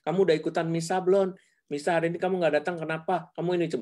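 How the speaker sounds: fast, high questioning like a scolding parent; nagging parent. Retold or imitated. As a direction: rattle off the checklist like a nagging mom. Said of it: "Bukan cuma". (orang tua cerewet, cepat dan tinggi)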